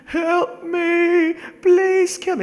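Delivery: high, loud begging voice; pleading victim voice. Pretend. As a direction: beg to be put down. Voices game character pleading.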